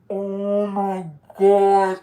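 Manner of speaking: stunned oh my God. Retold or imitated. Remembering her own reaction in class, stunned and drawn out. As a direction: let the words hang in disbelief.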